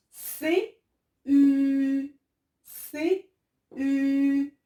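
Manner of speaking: "C, U" high and loud, U held long; spelling out. Shown as a demonstration. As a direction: chant out "C, U" letter by letter. Teacher sounding out letters.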